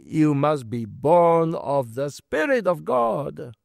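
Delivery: ordinary talk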